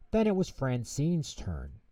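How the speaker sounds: plain reading voice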